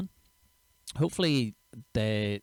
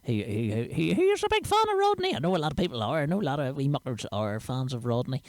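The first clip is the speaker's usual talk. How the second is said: a lightly voiced Rodney, pitched higher in bursts; hint of a cheeky wee man character, higher on the big words; 4 semitones higher than usual. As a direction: slip in and out of a squeaky wee Belfast character. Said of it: "as Rodney".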